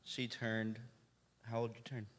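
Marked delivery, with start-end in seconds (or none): none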